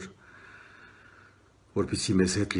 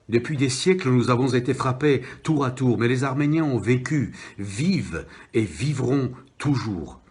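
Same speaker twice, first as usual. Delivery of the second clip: impassioned declamation, high and loud, slowing on the refrain; poem recitation; 4 semitones higher, 4 dB louder than usual. Acted.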